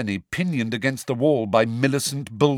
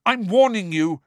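louder and higher, a threat; warning; 11 semitones higher, 4 dB louder than usual. A narrator's reading. Harry threatens the house elf, warning. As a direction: warn him sharply. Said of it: "said Harry".

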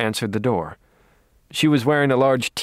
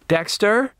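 high, rising call from another room; 10 semitones higher than usual. A narrator's reading. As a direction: call the name questioningly into the next room. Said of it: "Rita called from the next room".

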